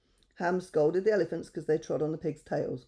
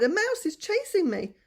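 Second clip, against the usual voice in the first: panicked plea; 14 semitones higher, 5 dB louder than usual. Acted. Lion panicking.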